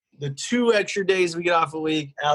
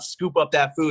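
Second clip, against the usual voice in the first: ordinary talk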